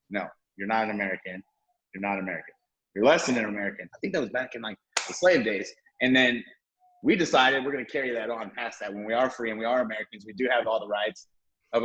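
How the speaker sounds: ordinary talk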